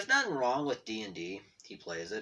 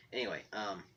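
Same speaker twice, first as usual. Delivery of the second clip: ordinary delivery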